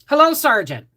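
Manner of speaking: high, loud cheery hello; chirpy young private. Retold or imitated. Young private greeting his sergeant, high and loud.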